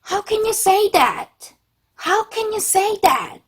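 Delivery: high-pitched, drawn-out hurt protest; 감정이 상한 듯. Shown as a demonstration. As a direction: say it slowly, high, as if wounded and disbelieving. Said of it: "여러분 막 감정적으로 상했을 때". (상처받아 높고 느리게 따지는 말투)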